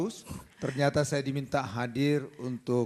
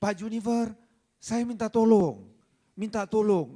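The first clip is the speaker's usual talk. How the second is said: higher-pitched pleading wife, lightly imitated; mock tearful plea; 7 semitones higher, 4 dB louder than usual. Wife begging the lawyer for help, mock tearful. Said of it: "pada saat dia nangis".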